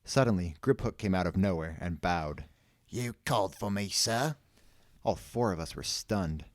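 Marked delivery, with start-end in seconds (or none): 2.9-4.4 lightly voiced deferential servant; goblin servant. A narrator's reading. Goblin answering a summons, mildly deferential. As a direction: answer with a hint of a formal butler.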